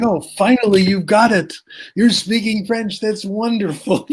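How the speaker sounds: high-pitched, gushing praise; hammed-up thrilled teacher; mock delight.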